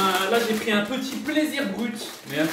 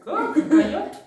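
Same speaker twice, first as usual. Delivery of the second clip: put-on puzzled shop girl; 10 semitones higher, much slower than usual. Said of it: "Elle me dit".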